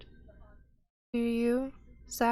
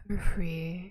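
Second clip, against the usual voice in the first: whispered. Acted. GM NPC ends in whisper.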